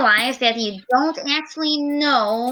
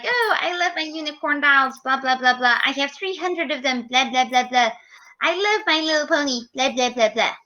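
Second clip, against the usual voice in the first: fast excited gushing; 2 semitones higher, faster than usual. Shown as a demonstration. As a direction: rattle it off like a fan. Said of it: "You might get to speaking really fast".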